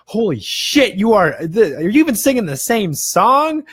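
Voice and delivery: loud, high-pitched incredulous outburst; mock disbelief. His sober musician self scolding his drunk singing, mock disbelief. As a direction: call yourself out, appalled and amused. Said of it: "a part of me went, took a step back".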